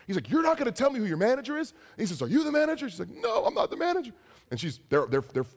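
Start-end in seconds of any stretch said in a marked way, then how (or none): 0.3-1.7 high, quick mock outrage; incredulous customer. Customer challenging the cashier about the manager, incredulous. 2.2-2.9 high-pitched pointed question; accusing customer. Retold or imitated. Customer confronting the nervous cashier, accusing and high-pitched. 3.1-4.1 very high, panicky mock denial; scared cashier. Scared cashier answering an angry customer, high-pitched panic. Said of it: "one of them's scared".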